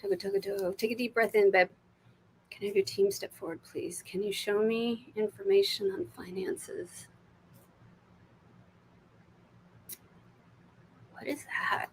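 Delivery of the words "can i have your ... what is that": whisper. Real, not acted. Asking spirit guides for information.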